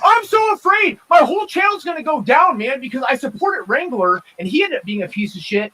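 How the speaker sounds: high-pitched mocking whine of fear; hammed-up worry, mimicking a scared streamer; mock panic. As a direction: put on a panicky high voice to ridicule him. Mockingly voices opponent's fear for channel.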